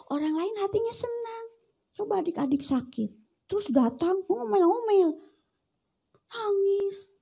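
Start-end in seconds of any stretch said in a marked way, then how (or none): none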